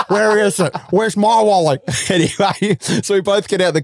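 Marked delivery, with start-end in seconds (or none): 0.1-1.8 high-pitched mock panic; imitating a mate frantically searching; transferred panic. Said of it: "all of the panic and anxiety that I had".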